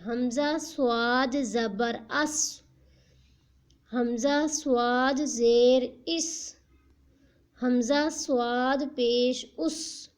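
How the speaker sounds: put-on slow, deliberate spelling-out for children; slow syllable drill. Shown as a demonstration. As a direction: spell each sound out slowly for a young learner. Teacher drills Qaida syllables slowly.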